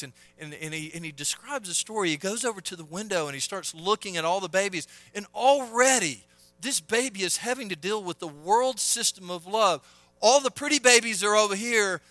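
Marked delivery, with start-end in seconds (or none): 5.1-12.0 loud, raised pulpit protest; indignant preaching. Real, not acted. Preaches at full force.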